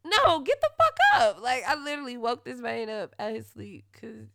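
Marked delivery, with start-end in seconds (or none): 0.0-1.4 loud, much higher yelling; furious shout. Retold or imitated. Yelling at sleeping boyfriend about her dog, furious. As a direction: scream him awake over the dog. Retold fight over dog.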